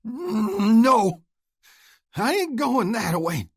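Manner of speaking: high, loud desperate defiance; defiant refusal. Acted. Wounded Oscar refuses to hang, defiant. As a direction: refuse it with pained fury.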